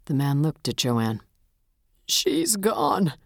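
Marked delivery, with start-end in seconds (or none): 2.1-3.1 put-on desolate old man, pitched high, voice breaking; cracked and desolate. A narrator's reading. Bereaved husband tells the detective of his loss, cracked and desolate. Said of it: "his voice cracked and desolate".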